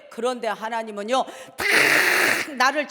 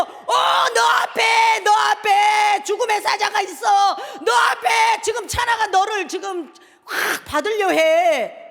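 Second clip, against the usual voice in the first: loud, higher urgent yell; shouted warning; 7 semitones higher, 6 dB louder than usual. Shown as a demonstration. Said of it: "소리 질러야죠". (다급하게 외치는 경고)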